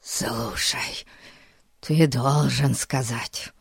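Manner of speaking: breathless whisper; whisper, gasping with excitement; out of breath whisper. A narrator's reading. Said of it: "задыхаясь, прошептал".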